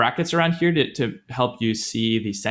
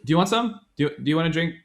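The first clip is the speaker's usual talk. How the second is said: friendly offer; 6 semitones higher, faster than usual. Shown as a demonstration. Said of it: "think of it as, like".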